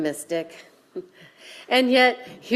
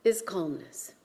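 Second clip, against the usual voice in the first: much softer, hushed calm after the storm; 3 semitones lower, 7 dB quieter than usual. Acted. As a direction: let it drop into stillness. Contrast: calm eye of storm.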